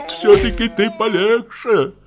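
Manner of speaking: high, whining plea; almost in tears, begging restraint; tearful voice. A narrator's reading. Pochechuev tearfully begs the barber to go easier. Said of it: "проговорил плачущим голосом".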